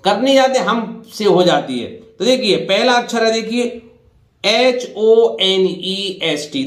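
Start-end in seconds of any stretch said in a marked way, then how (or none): 4.4-6.6 slow letter-by-letter spelling; spelled out slowly. Shown as a demonstration. Teacher spelling a word slowly for students.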